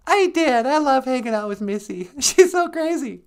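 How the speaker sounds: very high, loud gushing voice; high-pitched cartoonish chatter; squeaky sidekick. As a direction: chat excitedly as a tiny sidekick. Sidekick voice answers host.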